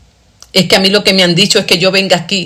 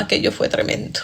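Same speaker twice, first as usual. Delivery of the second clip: whispered, much softer; hushed whisper; 10 dB quieter than usual. Real, not acted. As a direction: breathe it out as a pained whisper. Painful memory of her mother.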